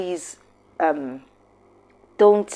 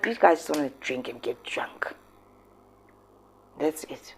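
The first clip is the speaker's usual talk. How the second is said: softer, disgusted whisper; scornful whisper; 8 dB quieter than usual. Real, not acted. Vlogger whispering about after-tears partygoers. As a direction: hiss it like a disapproving aside.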